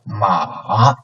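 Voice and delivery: ma dipping low, then rising a little; third tone. Shown as a demonstration. Teacher models ma for repetition, low dipping third tone. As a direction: sink ma to the bottom, then lift its end. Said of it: "this is like a down coming".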